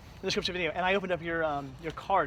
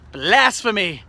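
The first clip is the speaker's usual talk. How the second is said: mock outrage; 3 semitones higher, 7 dB louder, much slower than usual. Mock outrage at a friend's shirt.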